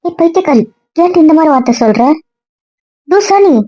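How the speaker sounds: angry outburst. Acted. Yelling at her insult.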